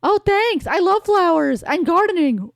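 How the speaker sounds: a hint of a sweet girl; voicing Aerith.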